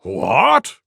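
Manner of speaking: loud startled what; roar. Acted. Tiger roars at Elephant's news. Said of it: "roared Tiger".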